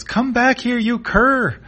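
high, loud chasing yell; furious cartoon shout; angry yelling. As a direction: yell after a runaway, stretching the last "here". Kira shouts after fleeing Ruddock.

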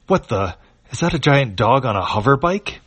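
startled, rising disbelief; surprised. Acted. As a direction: blurt it out in shock. Man sees giant dog on hoverbike.

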